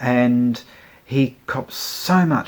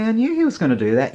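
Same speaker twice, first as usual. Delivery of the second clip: smug admission; 8 semitones higher, much faster than usual. Retold or imitated. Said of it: "And I".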